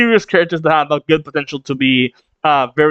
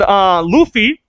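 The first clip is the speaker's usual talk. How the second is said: ordinary talk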